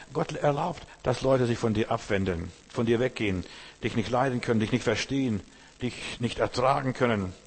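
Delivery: ordinary talk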